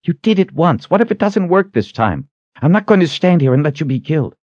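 high, urgent female character voice; anxious high-voiced protest; worried woman. Kalen pleads with Richard.

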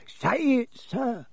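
high, slow elf ultimatum; high-pitched, pleading elf; insistent house-elf. Acted. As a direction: insist gravely in a tiny creature's voice. Dobby presses Harry in figure voice.

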